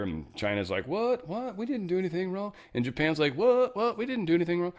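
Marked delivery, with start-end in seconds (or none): 0.9-2.5 much higher, fake-innocent squeak; China protesting innocence in a high mock voice. 3.4-4.7 much higher, fake-innocent squeak; mock innocent Japan. Japan mirrors China's line.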